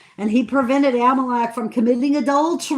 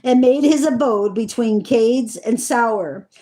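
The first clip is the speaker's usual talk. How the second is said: measured, solemn Bible reading voice; scripture reading; 4 dB louder than usual. Acted. Reading Genesis to her listeners, in a solemn reading voice. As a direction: read the old words with weight and care.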